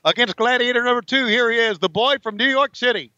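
ring announcer. Acted. Announcing the wrestler to the crowd, ring announcer style. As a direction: introduce him like the ring announcer.

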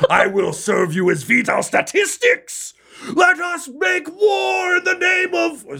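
high pledge rising to a shout; sworn champion. Acted. Revived fighter pledging to the leader, rising war cry. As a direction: swear it, then cry out for war.

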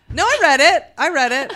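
loud, high defensive insistence; loud protest. Real, not acted. Defending herself against co-host's jab.

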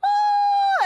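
very high, shouted ooh of astonishment; mock group gasp. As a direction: imitate gawking guys with a high whoop. Imitating the guys' reaction.

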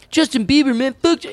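lightly imitating a snide hater; mock hater. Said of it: "people are like".

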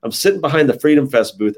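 ordinary talk

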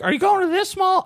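put-on high, wheedling old man voice; high-pitched old neighbor impression. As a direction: imitate an old man asking sweetly, way up high. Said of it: "He's like".

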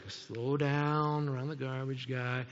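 slow-motion grumble to oneself; drawn-out slowing down. Retold or imitated. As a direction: drag it out as if creeping along. Retells creeping past garbage truck.

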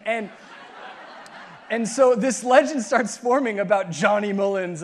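usual speaking voice

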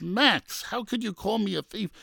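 high, soft voice of hurt disbelief; wounded protest. Retold or imitated. Butcher to the grandfather who called him thief, high wounded protest. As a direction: protest up high, acting the accused.

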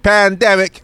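loud, high-pitched holler; mock scream. Co-host hollering about the hiatus, mock screaming. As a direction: shout it out like a street cry. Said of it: "screaming, "Pandemic".

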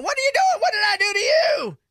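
high, shouted mock panic; imitating a scream. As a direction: yell it like a man being attacked. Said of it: "he was going".

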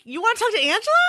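put-on high-pitched, playful question to a child; playful mommy. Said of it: "so I'm like".

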